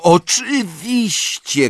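high, drawn-out exclamation; sudden cry of realisation. A narrator's reading. Hermione slaps her forehead, crying out. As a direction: cry it out slowly as it dawns. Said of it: "krzyknęła Hermiona".

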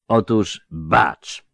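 mocking older soldier, drawn out; slow lisping veteran. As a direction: lisp it slowly with sly irony. Szczupak slow threat.

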